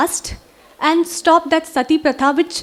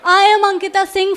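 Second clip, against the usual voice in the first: normal talking voice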